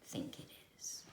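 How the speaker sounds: whispered question. A narrator's reading. Narrator whispers a question to listening children. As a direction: lean in and whisper it.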